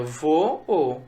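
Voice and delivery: "vo, o" in two distinct beats; "vo, o" with a gap before the lone o; split into syllables. Shown as a demonstration. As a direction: break "vo, o" between the identical vowels. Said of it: "Eu separo, olha".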